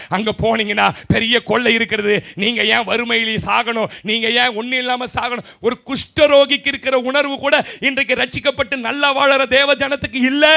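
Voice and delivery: loud, driving sermon delivery; full-force preaching. Acted. Preacher urging believers with the lepers' good news, full-force preaching. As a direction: preach it loudly to the whole hall.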